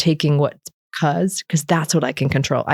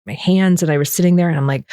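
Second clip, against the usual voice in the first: ordinary delivery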